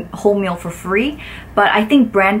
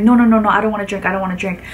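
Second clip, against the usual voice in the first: fast mock no-no-no; imitating quick refusal; much faster than usual. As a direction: rattle off her refusal quickly.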